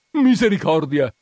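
loud, high cry of dismay; alarmed shout. A narrator's reading. Agnese hearing Menico's distant scream, alarmed shout. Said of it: "grida anche Agnese".

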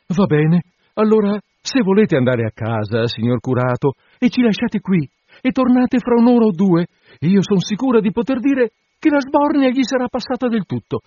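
put-on high, pleading young woman's voice; anxious bride. Bride coaxing the curate to wait, high and pleading.

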